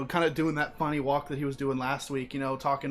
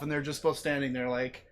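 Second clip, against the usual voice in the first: normal talking voice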